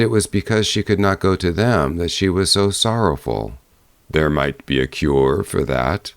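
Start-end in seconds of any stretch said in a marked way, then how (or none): 4.1-6.1 low, steady bear voice; calm her as a big bear; reassuring bear. A narrator's reading. Bear telling the girl to hold on, low and reassuring.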